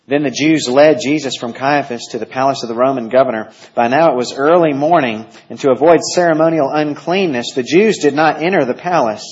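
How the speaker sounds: loud, ringing Bible reading to the church; scripture reading. Acted. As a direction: proclaim the passage to the congregation.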